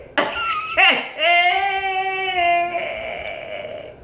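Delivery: high drawn-out wailing laugh; gleeful high-pitched cackle into a held wail; long high squeal. Real, not acted. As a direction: squeal at length in delight. Gushing over favourite actress.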